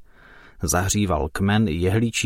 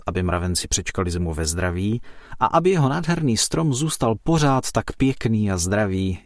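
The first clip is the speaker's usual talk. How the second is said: plain reading voice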